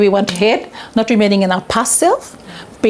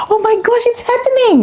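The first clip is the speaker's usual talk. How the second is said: much higher, breathless excitement; thrilled disbelief; faster than usual. Retold or imitated. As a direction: squeal that it's finally happening. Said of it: "I was going".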